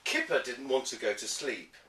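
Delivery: plain reading voice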